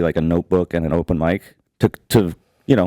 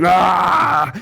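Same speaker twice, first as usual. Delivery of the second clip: long, high mock scream; imitated angry roar; 9 semitones higher than usual. Jogger enraged by the laughing, imitated angry roar. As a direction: act out rising rage in one yell. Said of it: "more and more angry".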